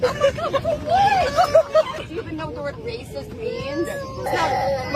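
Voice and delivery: high, near-crying disbelief; shocked outburst. Real, not acted. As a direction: squeal it, outraged and stunned. Passenger shocked at racist accusation.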